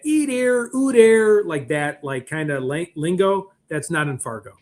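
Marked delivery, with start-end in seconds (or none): 0.0-1.4 higher, teasing imitation of a Canadian border accent, louder on ooh there; lightly hammed-up northern Minnesota-Canadian accent; mock Canadian border accent. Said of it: "to get that".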